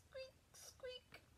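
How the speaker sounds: tiny, very high, soft squeak; high-pitched whispery mouse squeaks. Acted. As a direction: squeak softly like a little mouse. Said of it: "What does that sound like".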